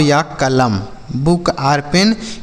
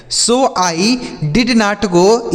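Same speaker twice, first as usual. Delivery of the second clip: very slow model reading; 4 semitones higher, much slower than usual. Shown as a demonstration. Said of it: "teacher repeats model sentence".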